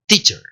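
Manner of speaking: "teacher" with the final E reduced to a faint schwa; correct English pronunciation. Shown as a demonstration. Teacher leading a repeat-after-me drill, correct weak ending. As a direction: model "teacher" clearly, weak last vowel. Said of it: "Decí conmigo".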